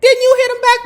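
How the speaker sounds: very high, loud mocking echo; mock the brother egging on; mock shrill echo. Host mocks Jermaine's question.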